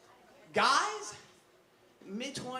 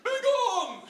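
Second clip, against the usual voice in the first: indignant; 3 semitones higher, 4 dB louder, slower than usual. Acted. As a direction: play the offended gentleman, ending in a loud dismissal. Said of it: "I'm all indignant".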